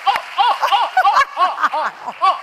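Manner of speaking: high, loud stammering oh's; mock the woman's flustered shock; shocked gasps. The offended woman caught off guard, flustered high gasps. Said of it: "she was like".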